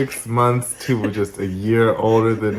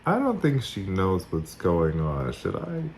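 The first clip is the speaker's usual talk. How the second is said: softly voiced, tentative question; hushed aside; 11 dB quieter than usual. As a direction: ask it quietly, as a discreet aside. Translator's hesitant aside, acted.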